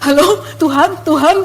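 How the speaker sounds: questioning call, rising at the end; naive, tentative. Retold or imitated. Young believer answering an unseen God, naive. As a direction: call out innocently, unsure who answers. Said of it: "masih polos ya kan".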